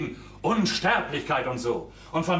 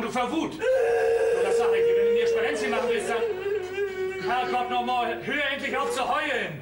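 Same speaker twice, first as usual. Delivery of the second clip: furious shouting. Acted. Fred yells at sobbing Olga in fury. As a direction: blow up at her, yelling. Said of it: "Du schreist du mich auch noch an".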